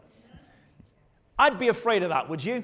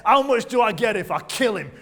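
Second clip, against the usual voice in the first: brash question; 4 semitones higher, 9 dB louder than usual. Retold or imitated. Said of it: "and he even says".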